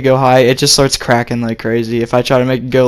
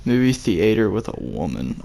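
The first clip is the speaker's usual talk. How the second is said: low voice; 5 semitones lower, 10 dB quieter than usual. Pretend. Echoes the category in a low voice.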